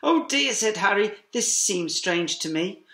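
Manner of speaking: usual speaking voice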